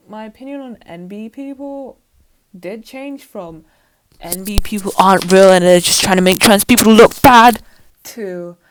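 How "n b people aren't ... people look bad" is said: loud, hammed-up mocking yell; mock shout. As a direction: shout it like a ranting bigot. Mocking own old opinion.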